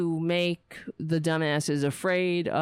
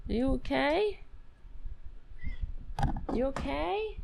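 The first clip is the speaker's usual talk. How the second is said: high, cooing question; higher pet-talk with rising ends; tender checking; 9 semitones higher, 5 dB quieter than usual. Real, not acted. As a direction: coo it up high to a shaken pet.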